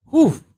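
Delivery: loud high whew. Real, not acted. Podcaster bracing for juicy recap, loud whew. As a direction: blow out a big whew. Reacting to next episodes.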